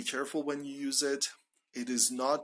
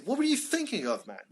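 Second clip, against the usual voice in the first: critical; 9 semitones higher, faster than usual. Acted. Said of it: "sometimes you need to be critical".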